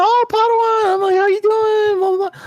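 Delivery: high, exuberant re-enacted hello; mock excited greeting. Two friends greeting at the event, excitedly re-enacted.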